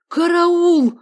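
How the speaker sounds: high, loud cry for help; alarmed shout. A narrator's reading. Man finds the flat wrecked, shouting. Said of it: "закричал Лаврентий Самсонович".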